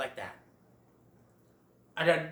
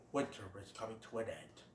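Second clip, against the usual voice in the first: much lower, much softer solemn voice; grave stag; 7 semitones lower, 15 dB quieter than usual. Retold or imitated. Great Prince to Friend Owl, low solemn.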